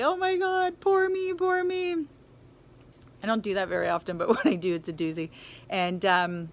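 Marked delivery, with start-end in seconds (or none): 0.0-2.1 high, whiny mock wail of self-pity. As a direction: ham up feeling sorry for yourself, much higher. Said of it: "And so I start crying uncontrollably".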